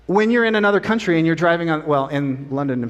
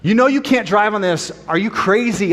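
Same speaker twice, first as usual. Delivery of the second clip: higher-pitched mock scolding; mildly raised, indignant retold yell; imitated yelling; 6 semitones higher, 3 dB louder than usual. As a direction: play the officer telling off a driver. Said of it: "he's yelling at you".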